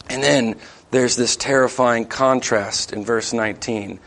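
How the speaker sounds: normal talking voice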